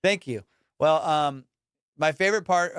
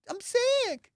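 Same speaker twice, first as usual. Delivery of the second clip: much higher squeaky admission; mock confession; 14 semitones higher, much slower than usual. Real, not acted. Admits obsession after imagined crowd.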